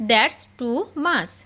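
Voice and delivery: slow and deliberate; slow repeat. Shown as a demonstration. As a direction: stretch each word out for the class. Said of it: "teacher repeats it slowly".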